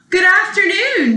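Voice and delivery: bright high "Good afternoon"; high sing-song greeting. Acted. Hen calling sweetly out the window, high. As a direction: lift the greeting high and loud.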